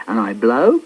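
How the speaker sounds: ordinary delivery, nothing marked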